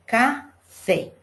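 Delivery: "café" split as "ca... fé", weight on FÉ; stress on FÉ. Shown as a demonstration. Teacher giving an oxytone example, stress on FÉ. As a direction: break "café" in two and land on FÉ. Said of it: "a sílaba que a gente pronuncia com mais força".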